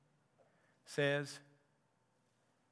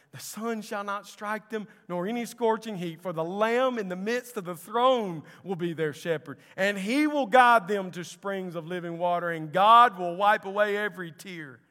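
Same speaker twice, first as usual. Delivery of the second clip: raised, loud scripture proclamation; preaching; 5 semitones higher, 10 dB louder than usual. Acted. Elder's promise of the Lamb as shepherd, preached forcefully.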